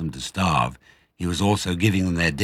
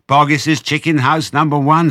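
high, loud spluttered rush; spluttering; 10 semitones higher, 9 dB louder than usual. Acted. Small fox blurting where the hens came from, spluttering high. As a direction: blurt it out in one breath. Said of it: "spluttered the small fox".

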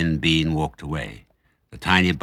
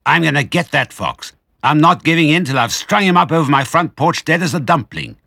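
angry vow; 8 semitones higher, 7 dB louder than usual. A narrator's reading. Bean vowing to the others to hang the fox. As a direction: swear revenge on the fox. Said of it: "he said angrily".

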